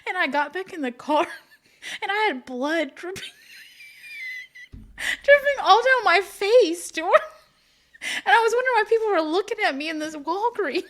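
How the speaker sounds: high voice breaking up with laughter; laughing while telling. Real, not acted. Telling Stuart about blood dripping down her face, laughing hard. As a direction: struggle to get the story out through laughs.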